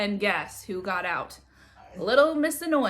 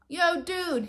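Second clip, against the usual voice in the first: surfer dude; 8 semitones higher than usual. Acted. Laid-back lifeguard greets kid.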